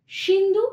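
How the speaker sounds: Sindhu said loud and high, rising; stressed key word. Shown as a demonstration. Teacher stresses the river name for the class. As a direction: call out Sindhu with a lift. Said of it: "ওই যে".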